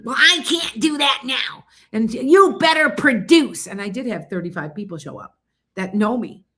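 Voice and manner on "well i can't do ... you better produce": slow, loud, high mock scolding; retold angry scolding. Retelling the host who demanded she produce, hammed-up slow scold. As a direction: lean on each word like a scolding boss.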